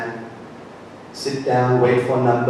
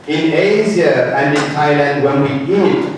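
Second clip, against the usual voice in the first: normal talking voice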